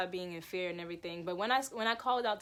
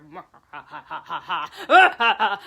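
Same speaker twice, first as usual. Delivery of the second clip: loud, strange echoing cackle; the devil's voice laughing in my head; sinister laugh; 14 dB louder than usual. Retold or imitated. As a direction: laugh like a dark presence. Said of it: "I heard a".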